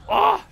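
sharp loud pained cry; startled yell. Real, not acted. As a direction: cry out when hit. Hit by laser.

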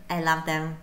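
ordinary talk

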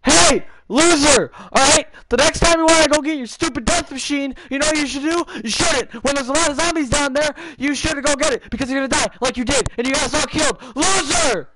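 high, full-volume scolding rant; angry yelling. Real, not acted. Player to kid who got team killed, angry yelling. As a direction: yell the whole telling-off at top pitch. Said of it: "I'm about to yell at this kid".

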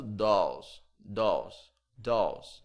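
"dolls" said "dóls", open O, L almost silent; teacher repeats "dolls" slowly as "dós". Shown as a demonstration. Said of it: "O U aqui como se tivesse um acento nele". ("dolls" com O aberto, "dós")

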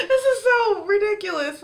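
high, loud, tearful voice cracking; words squeezed out through tears; crying. Real, not acted. Fan calling herself ridiculous, crying real tears. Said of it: "there is no reason for me to be crying right now".

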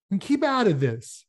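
snapped. Acted. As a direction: bite back at the gunman. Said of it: "snapped Ernie".

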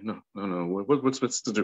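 ordinary delivery

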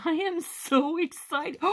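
high, slow, delighted gush; giddy high excitement; thrilled. Real, not acted. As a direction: gush over the new paper, drawn out and up high. Unboxing new craft paper.

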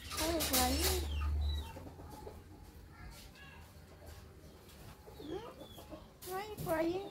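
gentle hushed concern. Real, not acted. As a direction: comfort a whimpering pet. Soothing crying pet.

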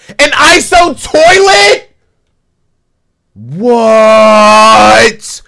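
loud, high, drawn-out "What?"; shocked shout. Real, not acted. Reacting to a surprise reveal, loud high shocked shout. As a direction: yell out in total disbelief.

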